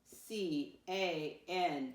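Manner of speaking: very slow, letter by letter; spelling out. Shown as a demonstration. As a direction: spell it out with space between letters. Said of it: "Tell me how to spell that word can".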